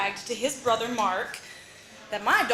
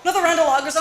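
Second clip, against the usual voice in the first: louder repeated order; calling the waitress; 5 semitones higher, 6 dB louder, faster than usual. Retold or imitated. Dad calling to the waitress for more lagers, louder. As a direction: call out to the waitress twice. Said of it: "waving to the waitress".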